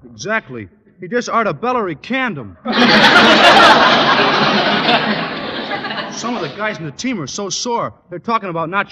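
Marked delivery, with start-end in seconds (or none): 2.6-6.1 loud, hearty guffaw; big booming laugh; goofy laugh. Real, not acted. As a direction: burst out laughing at your own joke. Stretch laughs at his own joke.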